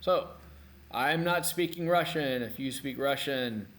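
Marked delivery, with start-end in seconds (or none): none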